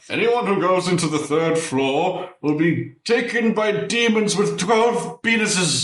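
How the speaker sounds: high, hammed-up announcement voice; Dumbledore. Dumbledore warning students off the third floor, in a high mock-proclamation voice. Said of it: "basically Dumbledore's been like".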